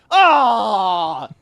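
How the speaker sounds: a loud high "ah!" of realisation; sharp exclamation. Retold or imitated. Drill sergeant suddenly understanding, loud high "ah". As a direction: bark out a sudden "ah".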